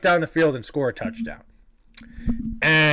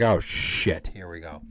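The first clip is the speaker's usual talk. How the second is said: low, trailing-off mutter of dread; resigned dread; 11 semitones lower, 5 dB quieter, slower than usual. Retold or imitated. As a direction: sink to a near-silent, resigned aside. Retold dread as police approach.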